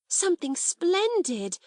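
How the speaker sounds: high and eager; excited hurry. A narrator's reading. Ermengarde telling Sarah her plan, excited. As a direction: bubble over with the news. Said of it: "in an excited hurry".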